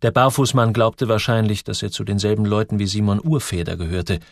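ordinary delivery

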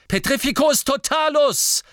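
high, forceful battle shout; shouted spell. A narrator's reading. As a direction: cry out the incantation at full attack.

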